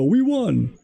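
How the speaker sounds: high, mock-triumphant "We won"; mock gloating cheer. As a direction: sneer at them by squealing "We won". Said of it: "your base to look at and go".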